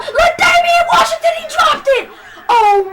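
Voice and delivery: high, loud shout, breaking into a laugh at the drop; frantic commentator yell turning to laughter; excited shout. Real, not acted. Dropped deep pass.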